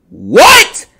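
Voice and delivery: sudden high shout of disbelief; shocked what. Real, not acted. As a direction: blurt it out, stunned and loud. Reading shocking stat on stream.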